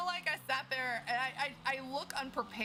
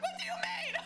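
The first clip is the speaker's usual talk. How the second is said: much higher, baffled; a high squeak of disbelief; incredulous; faster than usual. Retold or imitated. Retelling the trial, a squeaky disbelieving question.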